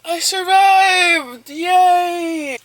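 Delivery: loud, high, slow triumphant shout; excited cheer. Real, not acted. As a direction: shout it out like a victory. Triumphant morning after night.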